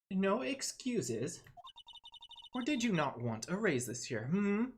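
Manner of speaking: stern boss reprimand, drawn out at first; older sister voice. Acted. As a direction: put the guard in his place as the big sister. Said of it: "her older sister voice".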